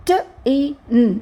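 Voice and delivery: "ట, ఈ, న్" as three separate sounds; sounded out. Shown as a demonstration. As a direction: spell "ట, ఈ, న్" by sound, pausing between. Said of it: "వీక్ సౌండ్స్కి ఒక vowel sound".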